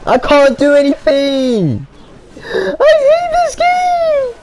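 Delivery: slow, high wailing rage; frustrated crying shout. Real, not acted. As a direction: wail it like the game betrayed you. Gamer losing, crying out.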